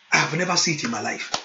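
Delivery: ordinary talk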